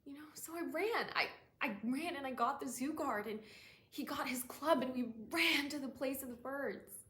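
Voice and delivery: loud, high, breaking into a rush; frantic urgency. Acted. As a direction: tell it as if still running for help. Monologue, frantic run for help.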